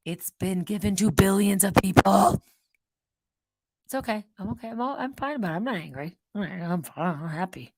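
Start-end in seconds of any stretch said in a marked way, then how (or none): 3.9-7.7 insisting I'm fine. Real, not acted. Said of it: "You're angry".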